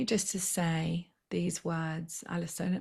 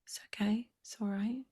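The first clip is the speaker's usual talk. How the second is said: soft reassuring whisper; quiet soothing reassurance; whispered comfort; 3 semitones higher, 6 dB quieter than usual. Real, not acted. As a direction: hush her gently. Comforting a tearful client.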